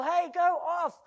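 loud, high shout of alarm; warning shout. Acted. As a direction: yell a warning to people heading for danger. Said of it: "We need to tell people".

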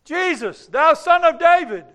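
high, full-voiced shout; loud, ringing public acclamation; crowd's cry. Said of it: "what the people's cry was".

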